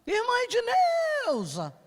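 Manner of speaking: high, slow, stretched-out shout of the name; drawn-out calling shout. Retold or imitated. Man at the door calling out to the sister, long drawn-out shout. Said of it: "E ele tá gritando".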